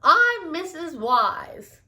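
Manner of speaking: a hint of a jolly shopkeeper. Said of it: "she said".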